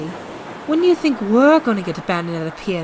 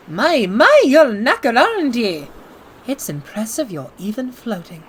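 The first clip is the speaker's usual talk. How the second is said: a worn-out German liner putting on a brave face; German accent. Acted. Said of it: "Where are you from? Deutschland?".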